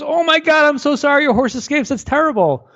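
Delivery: high-pitched frantic sympathy; alarmed neighbor. Acted. Neighbor rushes to the farmer whose horse escaped, high and alarmed. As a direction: act out a neighbor rushing over, voice way up high. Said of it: "The neighbor comes running over, goes".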